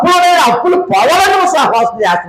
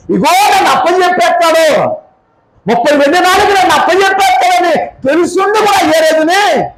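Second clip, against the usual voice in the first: higher, ringing proclamation; full-voiced sermon line; preaching; 7 semitones higher than usual. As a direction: declare it with fervour. Preacher voicing Jesus' knowledge.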